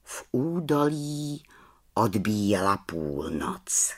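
ordinary delivery

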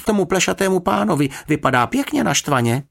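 put-on excited, high-pitched boy reporting trouble; exclaiming. A narrator's reading. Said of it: "vykřikl Jonatán". (vzrušeně, vysokým hlasem)

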